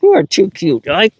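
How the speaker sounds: high cooing pet talk with a laugh; doting. Real, not acted. Trainer praising the perfect dog, high doting coo.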